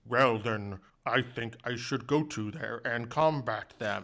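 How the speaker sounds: mock heroic protagonist voice; a weak guy forcing a strong hero voice; weak voice doing strong. Mocking the game's protagonist, forced heroic voice. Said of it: "a guy with a weak voice trying to do a strong voice".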